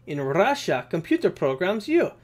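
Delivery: hammed-up fake Russian accent; bad Russian accent. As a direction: do a badly faked Russian accent. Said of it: "my worst accent ever, uh, Russian accent".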